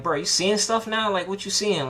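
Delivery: high anxious questioning; retelling his own rising panic. Retold or imitated. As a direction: pitch jumps up in nervous disbelief. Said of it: "I'm even more nervous".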